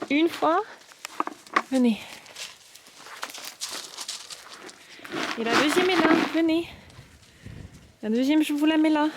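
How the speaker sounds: high sing-song coaxing. Real, not acted. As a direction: coax the sheep up high. Calling the sheep. (voix aiguë pour attirer)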